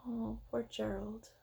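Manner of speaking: usual speaking voice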